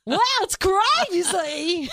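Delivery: high, loud, very slow happy-crazy drawl; a cheerful unhinged character voice, words pulled long; giddy madwoman stretching it out; crazy lady voice. Shown as a demonstration. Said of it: "the crazy lady voice is like".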